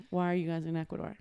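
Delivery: normal talking voice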